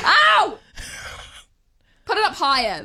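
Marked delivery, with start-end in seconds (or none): 0.0-0.6 loud, high ow of sudden pain; pain yelp. Real, not acted. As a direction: cry out as it jolts. TENS contraction simulation.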